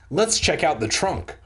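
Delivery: ordinary delivery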